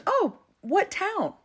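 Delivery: high, drawn-out imitated question; intrigued surprise. Stranger on the train presses mom, acted intrigued surprise.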